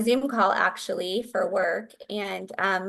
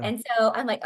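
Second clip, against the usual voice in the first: usual speaking voice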